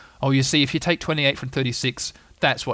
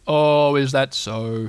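very slow, drawn-out donkey voice; gloomy Eeyore; 4 dB louder, much slower than usual. Acted. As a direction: draw it out, unimpressed. Eeyore's unimpressed drawl.

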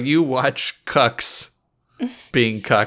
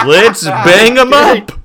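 loud, high, slow drawn-out hype call; fighting game announcer; 9 semitones higher, 12 dB louder, slower than usual. Pretend. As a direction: bellow it to the arena crowd.

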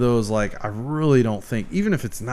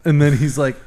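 usual speaking voice

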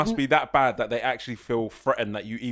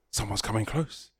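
softer hushed mock girl; lightly voiced wary girl; nature-documentary whisper; 5 semitones lower, 9 dB quieter than usual. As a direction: hush it like a wildlife narrator. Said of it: "the girl's like".

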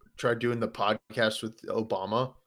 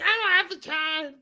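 mimicking wife. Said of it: "She's like".

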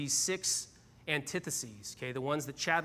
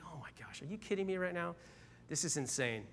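inner fuming; 3 semitones higher, 7 dB quieter than usual. Retold or imitated. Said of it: "Inside I'm like".

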